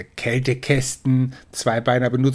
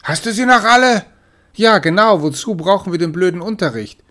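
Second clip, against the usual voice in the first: much higher and louder mocking; cheeky jeer; 7 semitones higher, 6 dB louder than usual. Siblings jeering at the lessons, high and loud.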